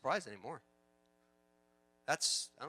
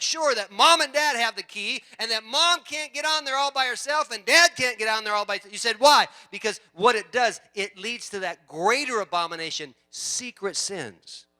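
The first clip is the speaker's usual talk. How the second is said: loud, high, emphatic preacher's delivery; preaching at full force; 7 semitones higher, 14 dB louder than usual. Real, not acted. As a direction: drive it home to the whole congregation.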